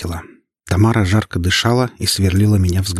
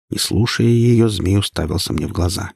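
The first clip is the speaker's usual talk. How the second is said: ordinary delivery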